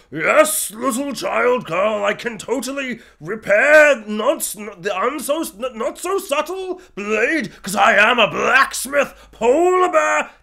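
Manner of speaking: put-on slow, drawn-out hammy polar bear accent; overacted talking polar bear. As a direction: put on a big, slow bear voice, stumbling over the words. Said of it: "polar bear voice".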